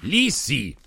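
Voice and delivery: loud, high blustering king; pompous king. Acted.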